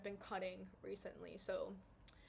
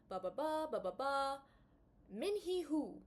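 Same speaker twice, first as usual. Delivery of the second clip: mock Southern substitute teacher reading the roster, higher and louder; southern accent; 5 semitones higher, 4 dB louder than usual. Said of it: "if I break out in accents".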